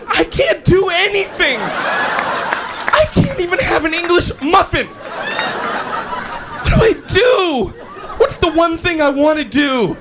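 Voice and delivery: very high, drawn-out comic wail; hammed-up despair. Late sleeper laments he has time to do nothing, mock-despairing wail. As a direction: wail helplessly in a high pitch.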